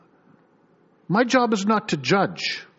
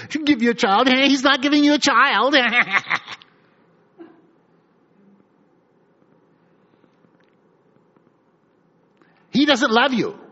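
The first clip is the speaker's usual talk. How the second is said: high, louder jeering with laughter; mocking taunt; 7 semitones higher, 4 dB louder than usual. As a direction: sneer and laugh at her. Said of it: "Phinehas would have sat beside".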